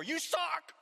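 high, loud mock insult yelled across the room. One hurt person yelling at another, mock angry yell. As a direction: fling the insult like a jab.

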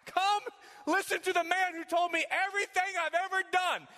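woman calls the town, excited. Acted. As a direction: call the whole town over, thrilled. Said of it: "she's so excited".

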